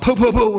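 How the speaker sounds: high, loud mock punch sounds; punch noises. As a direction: fire off each poo like a quick jab. Retelling knockdown punches.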